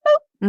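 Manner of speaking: a high, bouncy boop to close; playful boop. Acted. The guest ends her crash story to the host with a playful boop. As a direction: pop the word like a cartoon sound.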